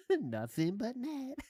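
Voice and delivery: slow, soft, drawn-out catchphrase; mock savoring. Host relishing the storyteller's catchphrase, soft slow mockery. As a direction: stretch it out softly with amusement.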